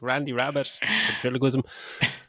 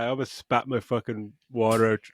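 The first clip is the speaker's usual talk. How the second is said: ordinary delivery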